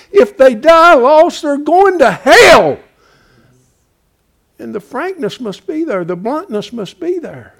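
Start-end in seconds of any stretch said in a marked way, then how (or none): 0.1-2.8 higher, louder and slowed; slow fervent warning. Acted. Preacher warning the lost, slow and loud. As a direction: drive each word home.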